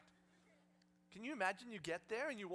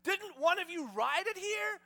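loud, high, slow indignant question; exasperated Jesus; 10 semitones higher, 11 dB louder, slower than usual. Acted. Jesus thrown in the dust scolding disciples, exasperated. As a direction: demand it in comic exasperation. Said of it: "And Jesus goes".

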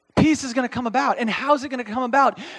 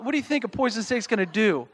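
usual speaking voice

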